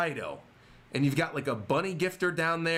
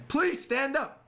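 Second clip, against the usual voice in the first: mild mock yell; 8 semitones higher, slower than usual. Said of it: "She's yelling again".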